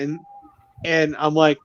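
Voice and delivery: ordinary talk, nothing marked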